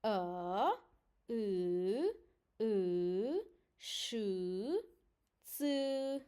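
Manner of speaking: held "A, I, U, Sh, Ц" sounds, each set apart by a pause; special-letter pronunciation. Shown as a demonstration. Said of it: "we have five special letters".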